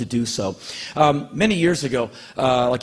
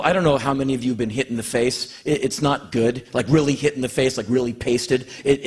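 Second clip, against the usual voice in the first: usual speaking voice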